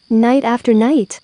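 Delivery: plain reading voice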